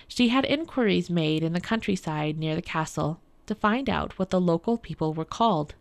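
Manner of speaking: ordinary delivery, nothing marked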